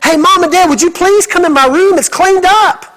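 put-on high, bright calling child voice; proud child. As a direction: call out like a kid showing off his room. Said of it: "Come back in there".

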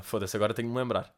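ordinary talk

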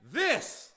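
"This" thrown high and loud with a rising tune; pointing This. Shown as a demonstration. Adam singling out his bride, "This" called high and loud. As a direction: fling out "This" as if pointing. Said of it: "and then at the end".

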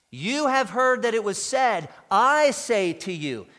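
loud, emphatic pronouncement; commanding authority. Retold or imitated. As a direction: declare it as the final authority. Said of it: "Jesus spoke with such authority".

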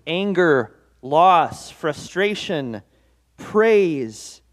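ordinary talk

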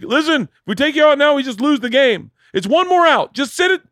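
hammed-up coach dad pushing his kid to stay in; pushy dad. Dad talking son into staying.

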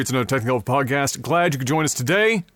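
ordinary talk, nothing marked

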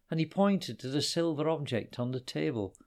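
ordinary delivery

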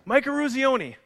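name yelled out high, pitch jumping up; calling out a name. Retold or imitated. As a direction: shout the name like a coach picking him out. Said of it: "he screams out".

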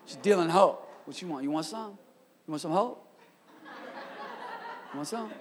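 hushed, furtive sales offer; mock street dealer. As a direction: offer it under your breath like a dealer. Mimes dealer offering hope on street.

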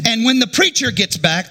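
normal talking voice

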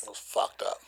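hushed voiceless whisper. Real, not acted. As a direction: drop to a whisper. Quiet aside.

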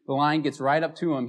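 usual speaking voice

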